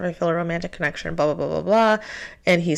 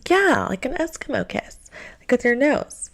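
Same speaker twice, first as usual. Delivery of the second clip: higher, eager mimic of the date; mock eager date; 7 semitones higher than usual. Date explaining an Eskimo kiss to her, mock eager. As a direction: play him up as keen and pleased.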